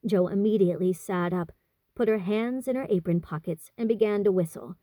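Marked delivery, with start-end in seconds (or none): none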